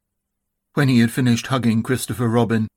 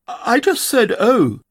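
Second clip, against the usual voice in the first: high, small anxious voice; nervous; 11 semitones higher, slower than usual. A narrator's reading. Piglet explaining himself to Eeyore, nervous. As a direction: squeak it out apologetically. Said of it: "said Piglet nervously".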